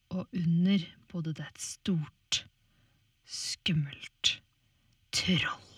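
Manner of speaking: hushed, scary whisper; spooky whisper. A narrator's reading. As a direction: whisper to build suspense. Narrator builds suspense.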